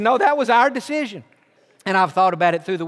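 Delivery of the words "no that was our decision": louder, higher firm correction; a retold father firmly overruling his son; insistent father. Father insists after son's doubt.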